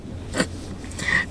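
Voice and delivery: a soft, unvoiced burst of laughter; breathy laugh. Real, not acted. Reader laughing at the script's strange claim. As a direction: laugh quietly at the absurd line. Reacts to absurd line.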